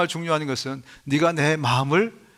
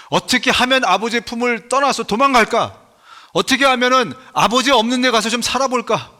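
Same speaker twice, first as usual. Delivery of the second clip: louder, higher voicing of the son's restless thoughts; prodigal son's musing; 6 semitones higher, 4 dB louder than usual. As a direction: pretend to be the son plotting to run off. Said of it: "이게 탕자의 마음이었어요".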